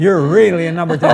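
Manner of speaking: laughing through the words. Retold or imitated. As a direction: say it while cracking up. Quoting praise, laughing.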